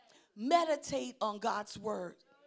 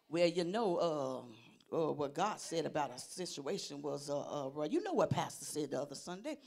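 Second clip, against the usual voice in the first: low, halting mumble full of filler; floundering churchgoer; 8 semitones lower than usual. Acted. Churchgoer asked for a scripture, floundering. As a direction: stall and grope for a verse in a low voice.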